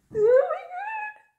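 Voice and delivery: high, slow squeal of disbelief; stifled scream. Real, not acted. Fan who just pulled a favourite, stifled scream. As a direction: squeal it while holding back a scream. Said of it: "trying not to scream out loud".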